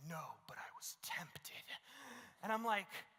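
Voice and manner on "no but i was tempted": gulping, slow whisper of a guilty friend; whispered confession. Retold or imitated. Friend whispers his confession to the pastor on the phone, slowly. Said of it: "gulps in and he's like".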